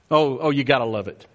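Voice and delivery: usual speaking voice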